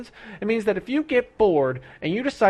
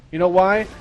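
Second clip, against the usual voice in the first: usual speaking voice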